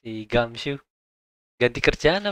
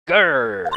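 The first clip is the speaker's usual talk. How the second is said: louder growled grr from the throat; tiger growl; 5 dB louder than usual. Acted. Voicing the circus tiger for the chat, a growl. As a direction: snarl like a big cat.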